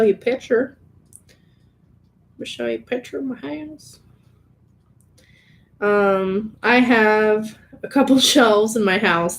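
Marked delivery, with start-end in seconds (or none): 1.3-4.0 put-on higher, softer country girl voice. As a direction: play up the country girl, quietly. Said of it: "I sound like a country girl right now".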